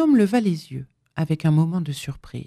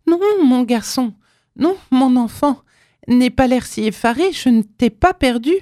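a mother's cry, louder and higher; 6 semitones higher, 4 dB louder than usual. A narrator's reading. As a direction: cry out to calm her son. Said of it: "s'écria-t-elle". (s'écrier plus fort et plus aigu)

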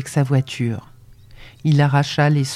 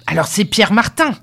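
angry guess; 4 semitones higher, 4 dB louder than usual. A narrator's reading. Farmer hurling another name at her.

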